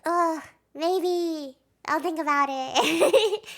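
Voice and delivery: mock reluctant laughing. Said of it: "Till's like".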